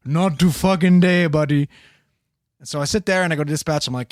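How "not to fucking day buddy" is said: slow mock Indian accent, drawn out. Old man threatening from his room, slow Indian accent. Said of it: "thick Indian accent".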